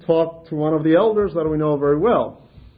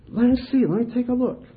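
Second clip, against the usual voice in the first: high, earnest play-along voice; mock serious elder; 8 semitones higher, faster than usual. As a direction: pretend to examine gravely. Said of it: "Very serious now".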